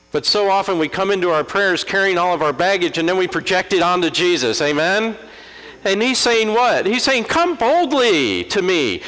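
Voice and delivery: high, loud preacher's cry, drawing out key phrases; impassioned preaching. Real, not acted. Preacher urging congregation to come boldly in prayer, preaching fervently. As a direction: lift the voice high and preach it with full force.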